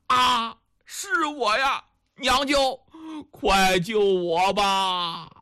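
tearful, drawn-out plea; nearly crying. Acted. Captive begging his uncle to save him, acted near tears. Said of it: "都快哭了".

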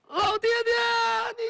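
high, tearful wail; crying for father. Acted. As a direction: break into a high wail over him. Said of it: "这才哭爹". (哭喊老爹爹)

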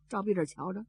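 usual speaking voice

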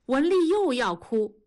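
ordinary delivery, nothing marked

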